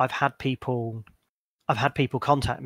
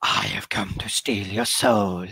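slow, lower demon voice; 4 semitones lower than usual. Shown as a demonstration. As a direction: intone it slowly like a demon. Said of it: "it's the demon voice".

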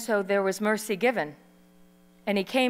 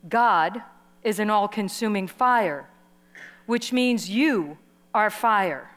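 usual speaking voice

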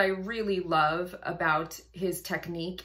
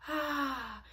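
breathy aspirated ah; 8 semitones higher, 4 dB quieter than usual. Shown as a demonstration. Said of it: "that little hiss of aspiration".

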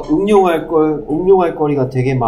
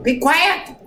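irritable shout. Retold or imitated. Teacher losing patience with chatter, irritable high cry. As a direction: snap it at a rowdy class, much higher. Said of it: "신경질적으로 막 그럴 때는".

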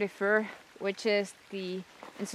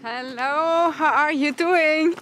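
louder, slow hearty hello; loud friendly greeting; 5 semitones higher, 6 dB louder, slower than usual. Real, not acted. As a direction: call out a warm greeting slowly. Greets friend loudly, slowly.

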